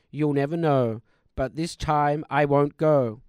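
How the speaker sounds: normal talking voice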